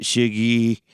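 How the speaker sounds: "shiggy", sugar turned into SHIG-ee; brothers' word for sugar. Shown as a demonstration. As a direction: say "shiggy" with short i and final ee. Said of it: "My brothers and I say it".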